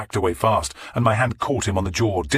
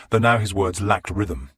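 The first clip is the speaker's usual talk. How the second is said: usual speaking voice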